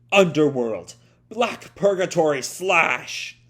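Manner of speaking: loud, slow, drawn-out finishing move cry; attack shout. Acted. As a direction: bellow the move name as you strike.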